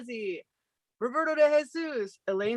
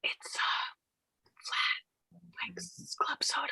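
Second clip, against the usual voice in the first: unvoiced tasting whisper; 10 dB quieter than usual. Shown as a demonstration. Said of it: "A S M R".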